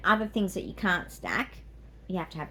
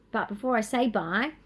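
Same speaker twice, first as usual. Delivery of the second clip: usual speaking voice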